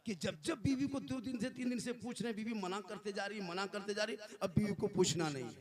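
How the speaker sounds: usual speaking voice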